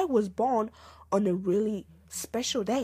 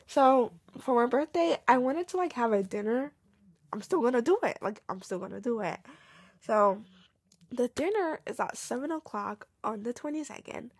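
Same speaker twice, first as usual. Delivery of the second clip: normal talking voice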